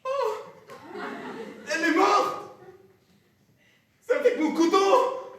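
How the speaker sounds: high, frantic, repeating horror; panicked horror. Acted. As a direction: panic over the body. Professor panics over dead girl.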